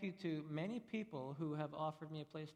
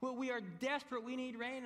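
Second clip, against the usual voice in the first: much higher, urgent and pleading; desperate farmer; 7 semitones higher than usual. Retold or imitated. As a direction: pipe up, desperate for rain. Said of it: "the next farmer pipes up".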